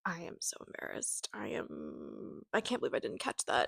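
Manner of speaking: mortified at security, whispering to herself; embarrassed whisper. Retold or imitated. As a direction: murmur it like you want to disappear. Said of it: "and I was like".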